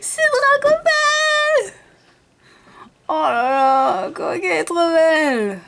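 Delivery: high, overjoyed cry; excited. Real, not acted. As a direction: squeal with delight. Thrilled over a rare card.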